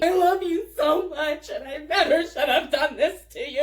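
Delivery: loud, hammed-up tearful blubbering; hysterical sobbing. Drunk friend sobbing all over the teller, loud mock-hysterical crying. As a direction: imitate a drunk sobbing apology. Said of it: "She's sobbing hysterically all over me".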